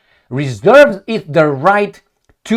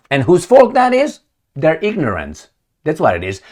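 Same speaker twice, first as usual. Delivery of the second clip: ordinary talk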